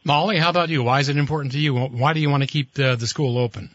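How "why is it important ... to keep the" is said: ordinary talk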